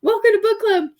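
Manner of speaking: bright, high welcome to the visitors; perky hostess hello; cheery greeting. Retold or imitated.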